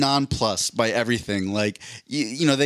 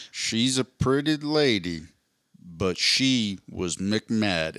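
slow, low deadpan drawl; imitating a deadpan southern drawl; deadpan southern sheriff; 4 semitones lower, much slower than usual. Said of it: "It was more like this".

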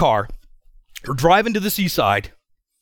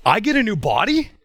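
I get a new body, pitched up with a rising, disbelieving tune; incredulous echo; 6 semitones higher than usual. Real, not acted. The speaker echoes his friend's words to the congregation, incredulous and higher. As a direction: repeat it in amazed disbelief. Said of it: "Of all the responses to everything".

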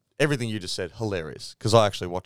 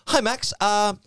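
higher-pitched, sheepish radio check-in; mock race coordinator; 5 semitones higher than usual. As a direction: imitate an awkward coordinator with nothing to report. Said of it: "The race coordinator for Red Bull goes".

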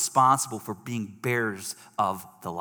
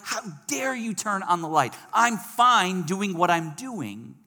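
higher-pitched indignant protest; angry retort; 7 semitones higher, 3 dB louder, slower than usual. Acted. Person resisting the light that was turned on, angry retort. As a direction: act out offended anger, dragging the last words. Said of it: "respond to that light in an angry way".

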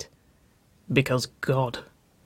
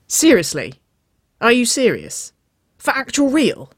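loud, excited questions climbing higher; jaw-dropped disbelief; 9 semitones higher, 6 dB louder than usual. A narrator's reading. Sister pressing for the truth, astonished. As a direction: demand confirmation in amazement. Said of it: "Wynona's jaw dropped".

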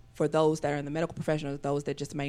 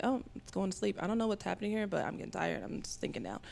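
put-on sleepy kid voice fading to a whisper; fading child; 3 semitones higher, 7 dB quieter than usual. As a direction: drift off into a whisper. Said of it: "the six-year-old's mom's like".

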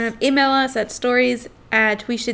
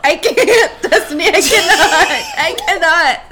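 loud, laughing, high; overwhelmed; 5 semitones higher, 5 dB louder than usual. Real, not acted. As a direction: gush it, overwhelmed. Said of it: "I, like, can't".

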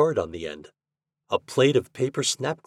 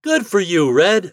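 put-on high, loud boyish cheer; congratulatory shout; 10 semitones higher, 7 dB louder than usual. A narrator's reading. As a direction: shout praise to a pal. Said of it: "shouted Toad".

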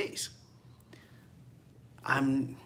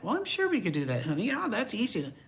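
higher, eager spouse voice; cheery spouse; 7 semitones higher than usual. Retold or imitated. Pastor imitates spouse's sweet agreement, higher. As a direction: answer sweetly to each other. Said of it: "couple answer".

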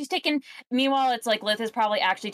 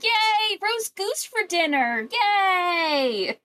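high, gleeful squeal with a slow middle; hammed-up cheer over a dead goose; mock delight; 13 semitones higher, much slower than usual. Stacy cheering that the chickens killed a goose, gleeful high squeal. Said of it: "And Stacy's like".

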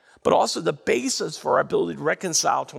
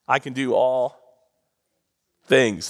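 loud, punched call; preacher calling the verse out; call-and-response verse; 6 semitones lower, 3 dB louder, slower than usual. Real, not acted. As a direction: belt it to the church. Calls verse for congregation response.

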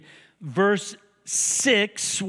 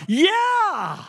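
triumphant yeah; 11 semitones higher than usual. Retold or imitated. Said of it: "You're like".

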